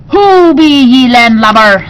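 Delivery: loud growling pirate. A narrator's reading. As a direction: bark it like a pirate captain. Pirate queen challenges stranger.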